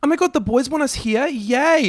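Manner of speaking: very high and loud, gushing glee; excited squeal. Retold or imitated. Mimicking early-2000s girls and gays invited by boys, high loud squeal. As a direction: squeal it like a thrilled party girl.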